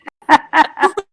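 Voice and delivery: loud, high burst of laughter; real laugh bursting out. Real, not acted. Guest finishing a story about police, laughing loudly.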